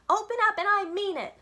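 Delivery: put-on high, loud child's yell. A narrator's reading. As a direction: yell it at the stuck door. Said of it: "I yelled".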